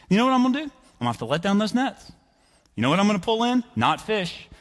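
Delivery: high-pitched comic grievance; exasperated arguing. Acted. Simon to Jesus about letting down nets, exasperated. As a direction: predict failure to make your point. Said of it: "I would start to reason with him".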